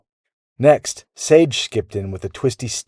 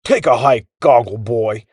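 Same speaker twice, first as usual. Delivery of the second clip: mild, raised-pitch shout; a lightly shouted brush-off; 3 dB louder than usual. A narrator's reading. Gear replays the boy's bossy words, mildly shouted. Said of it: "The gear kept shouting".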